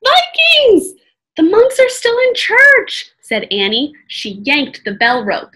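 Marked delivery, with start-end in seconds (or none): 0.0-0.9 loud, high alarm shout; crying out. A narrator's reading. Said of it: "Jack cried". 1.3-3.1 loud, high alarm; urgent exclamation. A narrator's reading. Annie urgent amid the alarm.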